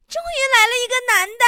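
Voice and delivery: put-on fast, high, delighted girl's call. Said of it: "一个悦耳的女生喊道".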